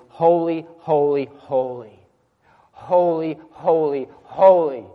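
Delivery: loud, ringing cry of the angels; crying out holy. Retold or imitated. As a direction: call it out like worshipping seraphim. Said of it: "they're crying out continually".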